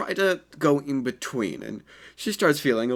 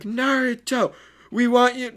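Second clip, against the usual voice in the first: put-on high, louder girlish voice; girly call; 12 semitones higher, 5 dB louder, slower than usual. Two girls rushing up to Naruto, high and eager. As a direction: squeal his name like a smitten girl.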